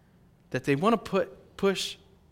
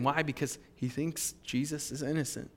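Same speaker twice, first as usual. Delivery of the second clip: ordinary delivery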